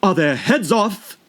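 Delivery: high, slow queen's demand; shouting queen. A narrator's reading. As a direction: call across to the soldiers. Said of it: "shouted the queen".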